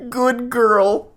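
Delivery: pitched high, a put-on sleazy voice; mock creepy guy. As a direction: play the creep saying good girl. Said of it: "And he goes".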